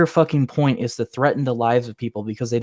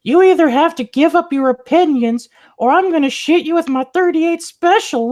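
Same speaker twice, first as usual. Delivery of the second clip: high-pitched, loud caricature of an irate opponent; mock threatener; 6 dB louder than usual. Speaker mimics people upset about opinions, shrill mock threat. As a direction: shout the threat in a silly, much higher voice. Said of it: "they would preference the conversation with".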